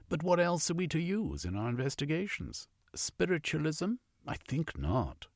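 a supercilious man turning suddenly polite; hint of a lofty gentleman scientist; haughty zoologist. Frenulum explains the hairy plague.

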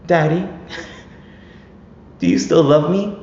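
put-on high, small pleading child voice; sweet little boy asking for love; son's plea. Son pleading to his dad, high and childlike. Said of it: "he leans over and he goes".

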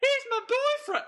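high-pitched shriek with a southern twang; Beverly impression again. Cohost asks for a repeat, podcaster shrieks Beverly's line in a high voice. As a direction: squeal it out, southern belle style. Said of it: "Please, please do that one more time".

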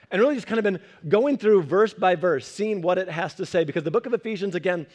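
normal talking voice

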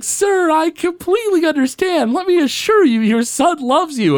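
high prissy schoolboy calming an angry dad; Martin Prince voice. Retold or imitated. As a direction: play the polite know-it-all schoolboy. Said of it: "Martin's like".

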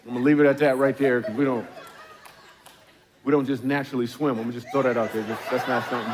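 chuckling through the words; laughing. Real, not acted. Pastor to congregation, joking about swimming while laughing. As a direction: let the laugh leak into the joke.

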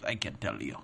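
put-on low, quiet Russian accent; Boris. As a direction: drop it low and soft, imitating a Russian technician. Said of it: "what was Boris's accent?".

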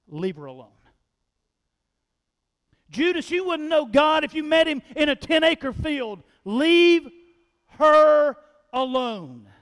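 Jesus rebuking Judas, loud and stern; a loud, stern rebuke of Judas. Acted. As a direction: drive him off with every word.

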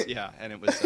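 ordinary delivery

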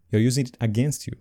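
ordinary talk

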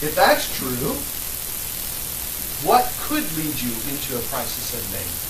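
loud, rising sermon oratory; impassioned preaching. Real, not acted. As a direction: preach it hard to the room. Preaching at full force after mic.

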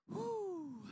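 very high whistly "whew"; mock recoiling whew. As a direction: hoot as if hit by a bad smell. Recoiling from the stinking grave.

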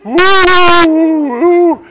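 loud high ghostly woo; spooky woo. Shown as a demonstration. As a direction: howl like a ghost. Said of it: "make spooky noises".

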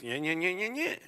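whiny mocking nyah-nyah, rising steadily; mock nagging tone. Imitating what you hear when your spouse says something, mock nagging. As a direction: parody a spouse's irritating tone. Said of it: "it's kinda like this".

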